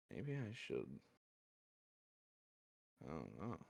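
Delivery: soft, low half-voiced musing; mumbling to himself. Man overheard musing by the one waking up, mumbled. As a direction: mumble it low, barely meant to be heard. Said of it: "you kinda hear him mumble".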